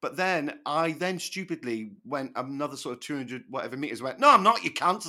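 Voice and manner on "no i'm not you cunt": loud, higher mock shout back at the louts; retold yell. Shouting an insult back at louts who chase him, loud. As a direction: fling it at them, cheeky and loud.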